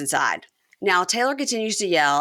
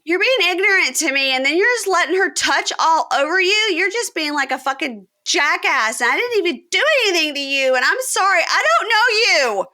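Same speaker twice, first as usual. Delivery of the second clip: very high, loud, whiny complaint; high shrill mock yell; 12 semitones higher, 5 dB louder than usual. Girlfriend yelling at a friend, mocked high and loud. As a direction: shriek it high with no bass. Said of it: "get some bass in your voice".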